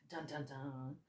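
mock doom sound effect; dramatic sting. Host introducing the pandemic topic, ominous mock sting.

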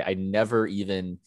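ordinary talk

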